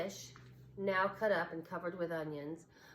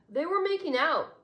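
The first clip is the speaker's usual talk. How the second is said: higher, louder donkey blurting it out; tattling donkey; 10 semitones higher, 9 dB louder than usual. A narrator's reading. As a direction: announce the gossip with glee. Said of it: "announced the Mexican donkey".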